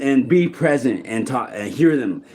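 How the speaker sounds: normal talking voice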